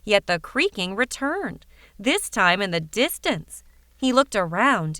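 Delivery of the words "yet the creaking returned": ordinary delivery, nothing marked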